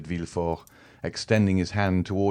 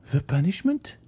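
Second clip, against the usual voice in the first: much higher, horrified rising question; aghast exclamation; 11 semitones higher than usual. A narrator's reading. Wife hears of punishment, high horrified exclamation. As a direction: recoil at the word in horror. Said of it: "exclaimed Madame de Villefort".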